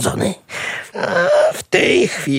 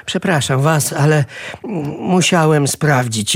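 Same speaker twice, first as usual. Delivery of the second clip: ordinary delivery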